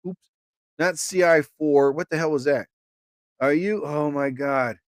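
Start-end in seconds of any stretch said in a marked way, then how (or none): none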